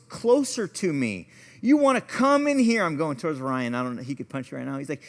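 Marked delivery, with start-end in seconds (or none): 1.6-2.8 high, loud retold challenge; yelled challenge. Box preacher to the man coming closer, yelling. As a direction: throw it at him as a mock confrontation. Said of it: "He's yelling at people".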